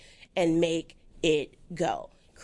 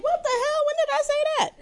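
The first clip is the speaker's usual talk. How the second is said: much higher, startled outburst; shocked future self. Pretend. Guest imagines reading her own quotes in a trade, shocked. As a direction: squeal it out as if caught off guard.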